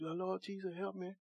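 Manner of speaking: very soft, prim little prayer; mock a dainty, half-hearted prayer; mocking a polite little prayer; cute prayer. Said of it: "you get cute".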